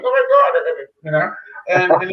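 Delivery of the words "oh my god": sudden much higher, starstruck gasp; inner fan squeal. Retold or imitated. Meeting Doctor Drew, inwardly starstruck and squealing. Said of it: "deep down inside, I'm like".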